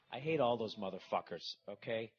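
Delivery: ordinary delivery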